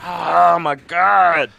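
high, loud, long-drawn exclamation; shocked groan. Real, not acted. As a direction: stretch it out in overwhelmed disbelief. Relief and shock after fight.

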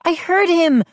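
high-pitched, urgent exclamation; excited cry. A narrator's reading. Mindy hearing the dog's bark, excited cry. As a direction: cry it out with sudden hope. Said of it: "Mindy cried".

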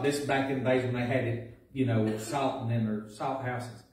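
usual speaking voice

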